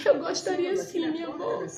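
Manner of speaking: put-on little girl's voice. Granddaughter answering her grandmother, shy and childlike. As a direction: answer sweetly like a small girl.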